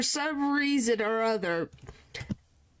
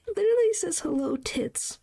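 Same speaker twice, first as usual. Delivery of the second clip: higher, giggly reread; laughing disbelief; 7 semitones higher than usual. Real, not acted. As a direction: crack up as you repeat it. Rereading it, cracking up.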